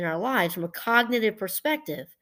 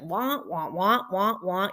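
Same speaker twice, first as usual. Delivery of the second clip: droning "wah-wah" of cartoon grown-ups; mock complainers' wah-wah drone; Snoopy cartoon adult noise; slower than usual. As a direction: imitate muffled whiners as wah-wahs. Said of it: "like the Snoopy cartoon with the adult noise".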